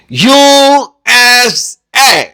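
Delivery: U S اے said very slowly, letter by letter; teacher stating the spelling rule; slow spelled-out rule. Shown as a demonstration. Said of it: "جب اے کی آواز دیتا ہے".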